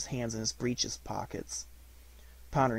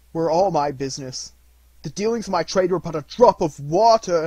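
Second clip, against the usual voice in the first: loud, raised, emphatic outcry; impassioned cry; 5 semitones higher, 10 dB louder than usual. A narrator's reading. Ghost rebukes itself before Scrooge, impassioned and loud. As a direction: drive each item home, loud and high. Ghost cries out, wringing its hands.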